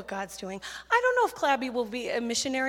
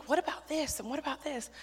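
high, soft questioning; wondering child; 3 semitones higher, 5 dB quieter than usual. Retold or imitated. Child lying in bed thinking about God, wondering child. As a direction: imitate a child quietly puzzling over big questions.

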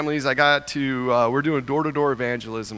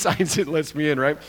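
normal talking voice

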